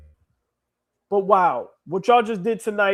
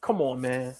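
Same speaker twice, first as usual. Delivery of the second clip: choked up; 6 semitones lower, much slower than usual. Real, not acted. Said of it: "gotta hold myself together".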